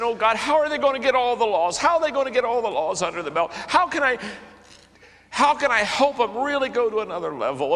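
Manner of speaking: high, pleading prayer to God; preacher retelling his worried prayer; retold pleading prayer. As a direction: cry out to God in worry.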